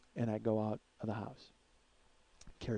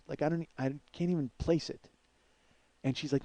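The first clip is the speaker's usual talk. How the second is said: ordinary talk, nothing marked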